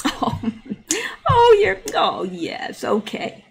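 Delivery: high "oh" then whispered patronising "okay"; imitating a condescending brush-off; mocking Canadians. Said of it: "And they're like".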